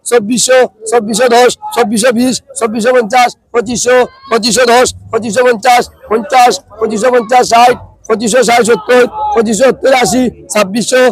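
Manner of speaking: steady rhythmic bid calling; auctioneer. Real, not acted. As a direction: chant the rising prices like a market auctioneer. Calling bids at fish auction.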